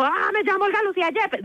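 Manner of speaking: high-pitched mock wail; wrong overwrought tone. Shown as a demonstration. Showing how not to give her name, mock overwrought cry. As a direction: blurt it high, in pretend distress. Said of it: "No, o sea, lo digo en un tono".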